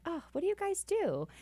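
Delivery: high-pitched, lightly voiced waitress quote; curious waitress. Waitress asks the brunch friends their work, high and curious.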